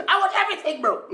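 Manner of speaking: high, thrilled fan voice; excited fan repeat. Retold or imitated. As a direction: say it again like an overjoyed fan. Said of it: "He's like".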